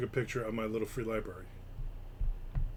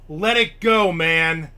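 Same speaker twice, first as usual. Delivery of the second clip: exasperated; 4 semitones higher, 11 dB louder, much slower than usual. Retold or imitated. Paraphrasing Pete's stance.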